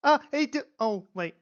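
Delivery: high, loud start, then dropping sheepishly; blurt, then catch yourself; flustered husband. Acted. GM voicing Benjamin caught making noise, flustered.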